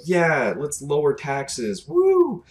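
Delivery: drawn-out mock cheer with a high whoop. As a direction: ham up a dumb crowd's cheer. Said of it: "so many people are just like".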